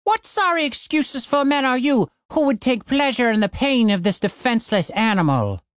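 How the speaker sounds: high, righteous challenge; defiant dwarf woman. Acted. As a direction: rebuke them with fury. Jorn defiantly confronting thugs.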